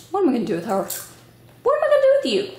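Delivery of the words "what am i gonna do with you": mock exasperation. Real, not acted. Mother asking her little one what to do with her, in high mock exasperation. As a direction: playfully scold in a high-pitched voice. Turns to scold child playfully.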